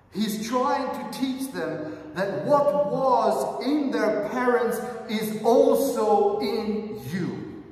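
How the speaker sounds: loud, high, slow pulpit drive; forceful preaching. Acted. Preacher urging the congregation to face their own temptation, at full force. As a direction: hammer the lesson home to the congregation.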